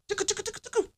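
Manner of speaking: high, soft tickle-tickle patter; playful tickling. Acted. Comic acting out tickling his feet, playful tickling voice. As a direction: tickle someone in a tiny, high, quick voice.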